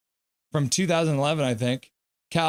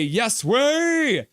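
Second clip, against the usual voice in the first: high, loud, stretched cheer; excited exclamation; 9 semitones higher, 5 dB louder than usual. Acted. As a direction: push it up and hold the last word. Reading out a fan's celebration.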